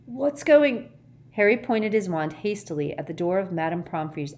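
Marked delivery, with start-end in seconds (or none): 0.1-0.9 high, loud waking outburst; startled yelp. Acted. Ron waking suddenly to a loud bang, startled yelp. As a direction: yelp it out, jolted from sleep. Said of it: "Ron awoke with a yelp".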